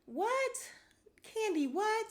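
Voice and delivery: can't believe what she just heard; stunned disbelief. Real, not acted.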